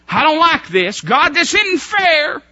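a mock childish wail of unfairness; whining protest. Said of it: "He didn't say".

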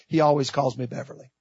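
low, very soft retold aside; hushed aside. Mother explains the name God uses, hushed aside. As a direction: drop right down, quiet and confiding.

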